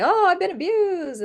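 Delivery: mocking false claim. Mimicking people who falsely claim abuse, in a mocking whine. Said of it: "And then they say".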